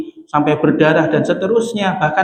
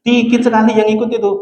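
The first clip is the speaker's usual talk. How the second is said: laughing; 5 semitones higher than usual. Real, not acted. Joke about few followers.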